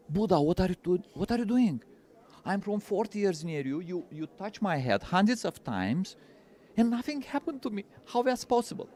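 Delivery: retelling Ananda's astonished plea; bewildered protest. Retold or imitated. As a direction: ask it as if you can't believe it.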